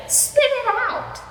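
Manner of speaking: high, slow and drawn out. Acted. Amelia disgusted.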